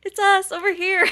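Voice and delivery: high, eager imitated call. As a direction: call over to get his attention. Fans calling out to him.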